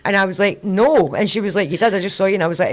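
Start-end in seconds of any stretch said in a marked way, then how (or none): 0.6-1.1 loud high no; denial. Retold or imitated. Mum caught by daughter, loud denial. 1.7-2.3 loud, higher accusation; accusing. Retold or imitated. Daughter accusing mum, loud and insistent.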